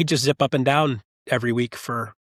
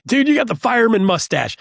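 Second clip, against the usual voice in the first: excited; 9 semitones higher, 4 dB louder than usual. Retold or imitated. Teasing a climbing partner.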